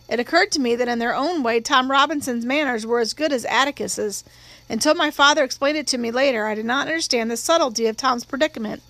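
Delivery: plain reading voice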